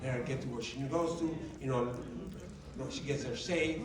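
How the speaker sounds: low, soft, earnest pleading; quiet prayer. Retold or imitated. Driver praying for the one who cut in, hushed. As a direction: pray softly under your breath, pitched low. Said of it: "I started praying for her".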